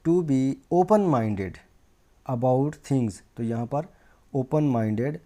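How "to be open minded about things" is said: slow, deliberate dictation of a sentence; teacher spelling out an example sentence; example sentence read slowly. Shown as a demonstration. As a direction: read it word by word for the class. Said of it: "तो एक sentence में भी हम देख लेते हैं".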